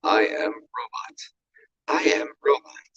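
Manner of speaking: put-on robot talk. Shown as a demonstration. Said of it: "why do I do that with my voice".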